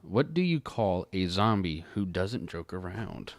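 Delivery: ordinary delivery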